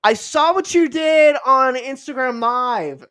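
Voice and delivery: hammed-up admiring fan; gushing fan. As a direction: fan tells the star she saw his stream, gushing. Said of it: "she goes".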